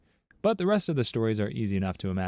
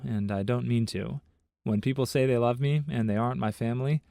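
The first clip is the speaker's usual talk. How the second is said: usual speaking voice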